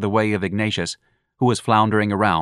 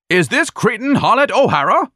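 bellowing; 13 semitones higher, 6 dB louder than usual. Acted. Ignatius keeps bellowing at stage.

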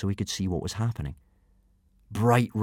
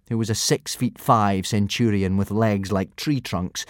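ordinary delivery

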